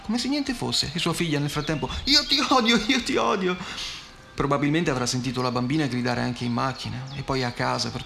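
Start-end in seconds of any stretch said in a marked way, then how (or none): 2.0-3.5 put-on high, loud toddler screaming; shrill child. A narrator's reading. Girl still screaming at her mother while she pays, high shrill cry. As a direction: keep wailing like the furious little girl. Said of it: "voce stridula della bambina".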